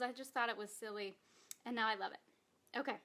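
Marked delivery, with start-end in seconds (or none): none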